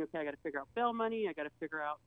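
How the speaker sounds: fast, quiet panicked thinking; racing thoughts. Retold or imitated. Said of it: "my head's go going".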